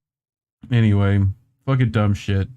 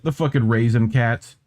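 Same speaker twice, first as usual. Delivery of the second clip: ordinary talk, nothing marked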